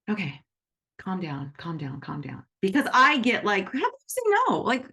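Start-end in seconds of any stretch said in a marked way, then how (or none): 0.1-2.4 soft, fading self-soothing murmur; calm down under your breath; calming self-talk. Retold or imitated. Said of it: "I have to really work hard and go". 3.7-4.6 high anxious mock question; panicky. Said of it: "then I start to get panicky".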